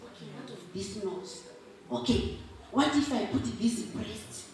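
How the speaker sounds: softly, lightly voiced God pondering his work; God musing. Speaker acts out God creating woman, musing softly. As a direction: think aloud quietly while crafting. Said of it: "he will be doing like this".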